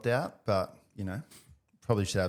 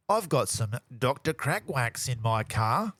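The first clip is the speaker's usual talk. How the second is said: mock Aussie; 2 semitones lower, slower than usual.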